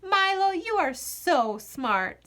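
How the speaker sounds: put-on girl praising her clever dog; squealed. A narrator's reading. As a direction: gush over the dog. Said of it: "squealed Maggie".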